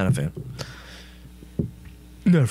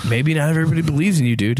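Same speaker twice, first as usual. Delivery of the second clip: fast dismissive; 4 semitones higher, much faster than usual. Acted. Darwin brushing God off.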